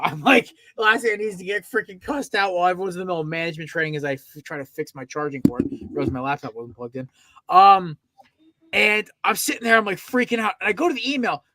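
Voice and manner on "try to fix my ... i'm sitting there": ordinary talk, nothing marked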